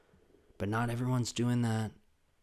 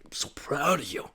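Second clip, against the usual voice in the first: imitating a choked-up, higher proud voice; tearful dad; 6 semitones higher than usual. Dad to his child in sentimental moment, mock tearful. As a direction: play a sentimental dad starting to cry. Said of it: "he cried in sentimental dad moments".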